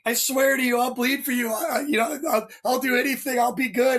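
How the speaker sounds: high, hammed-up begging; pleading recruit. Recruit begging the instructor for another chance, high hammed-up pleading. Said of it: "straight out of Blues Brothers".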